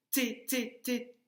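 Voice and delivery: "T" repeated as short, even beats; "T" said separately, like a syllable drill; isolated first syllable. Shown as a demonstration. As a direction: tap out "T" three times, each on its own. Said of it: "Donc, la première syllabe".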